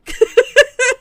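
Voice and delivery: loud squealing laugh; high burst of laughter. Real, not acted. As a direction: crack up loudly. Chat trolls her again.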